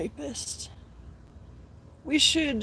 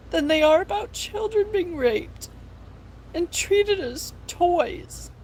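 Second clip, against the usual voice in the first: outraged rant; 6 semitones higher than usual. Real, not acted. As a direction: let the outrage rise up. Outraged rant about abuse.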